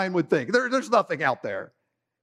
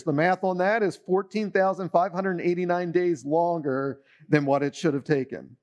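normal talking voice

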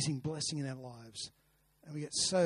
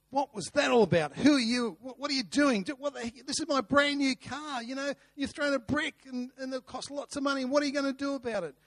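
put-on high, loud furious shouting at a kid; angry shout; 10 semitones higher, 6 dB louder than usual. A narrator's reading. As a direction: yell at the boy over the dented car, much higher and louder.

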